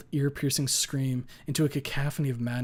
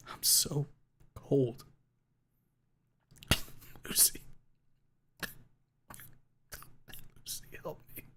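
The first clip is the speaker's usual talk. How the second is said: faint, breathless whisper; fading whisper; 3 semitones higher, 5 dB quieter than usual. A narrator's reading. Dying narrator whispering for Lucy's help. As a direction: barely get it out.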